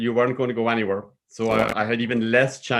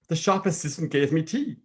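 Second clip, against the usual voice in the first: ordinary delivery, nothing marked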